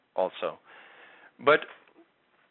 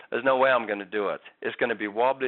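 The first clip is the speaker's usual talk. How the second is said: normal talking voice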